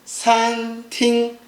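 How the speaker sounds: SAN TING, slow level syllables; sweet first tone. Shown as a demonstration. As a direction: hold san and ting flat on one pitch. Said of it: "is very sweet".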